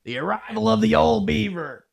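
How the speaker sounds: high, loud mock-grand announcement; comic announcer voice. Co-host announces the ship Beaver like a grand arrival. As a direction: announce it like a big entrance.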